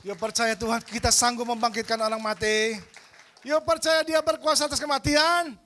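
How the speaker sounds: loud, high rousing call to faith; preaching at full force. Acted. Preacher rousing the congregation to believe, loud and rising. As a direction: lift the congregation with a soaring cry.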